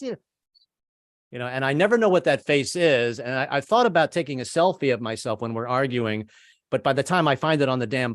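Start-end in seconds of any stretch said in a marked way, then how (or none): none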